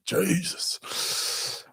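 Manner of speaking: long, high, soft breathy exclamation; drawn-out disbelief. Real, not acted. Streamer reacting to a viewer's comment, in drawn-out disbelief.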